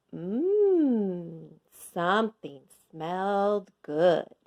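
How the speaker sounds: plain reading voice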